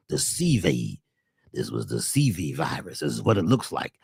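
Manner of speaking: deep, quiet mocking official voice; mock low announcer. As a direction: drop low like a smug official. Mocking what media told.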